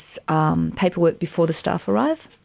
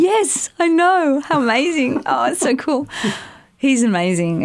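gushing; 8 semitones higher than usual. Real, not acted. Answering the host about five months on the shoot, delighted. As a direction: savour how thrilling it was.

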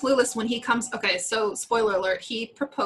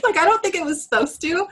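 high, louder, words breaking into laughter; can barely get it out for laughing; laughing through words; 8 semitones higher, 5 dB louder than usual. Real, not acted. Host cracking up describing the ugly ring, laughing.

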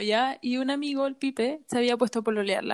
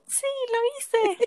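much higher, playful quote; high-pitched mock triumph; mock boasting; 13 semitones higher than usual. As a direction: imitate a proud little kid owning it. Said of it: "Me encanta, como".

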